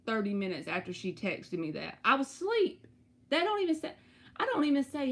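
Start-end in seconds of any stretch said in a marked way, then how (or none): 2.0-5.1 excited. Real, not acted. Creeped out retelling, voice jumps.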